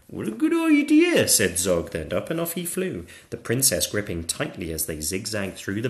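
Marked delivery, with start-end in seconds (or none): 0.1-1.3 high, loud keen little dragon; eager young dragon. Acted. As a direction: jump at the offer as a young dragon. Zog accepts eagerly.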